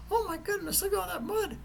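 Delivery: high-pitched imitation of an amazed daughter; voiced excited daughter. Daughter spotting mud ahead on the drive, voiced high in amazement.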